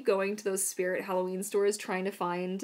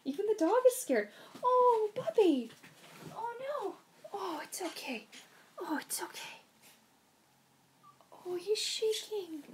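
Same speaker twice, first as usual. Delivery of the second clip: soothing the dog; 12 semitones higher than usual. Real, not acted. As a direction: coo him calm. Comforting frightened dog.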